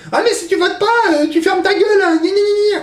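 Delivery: very high nagging mock voice; mocking old people. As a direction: whine it like a nagging old man. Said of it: "qui vont te dire".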